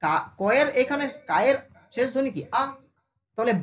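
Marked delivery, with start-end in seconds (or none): none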